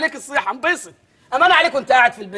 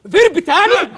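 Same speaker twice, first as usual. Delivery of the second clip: indignant; 5 semitones higher, 4 dB louder, slower than usual. Acted. Teacher outraged at English word.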